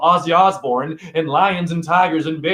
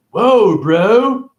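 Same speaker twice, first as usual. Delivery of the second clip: higher, louder, amazed bro voice; high, loud fake amazement; mock bro surprise; 7 semitones higher, 7 dB louder than usual. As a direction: play the dumbstruck bro. Voicing the anarchist's awe.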